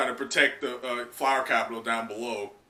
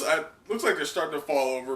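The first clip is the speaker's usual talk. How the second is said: ordinary delivery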